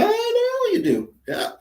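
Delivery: high mock voice. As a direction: squeak it up high and drawn out, teasing. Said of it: "It's like".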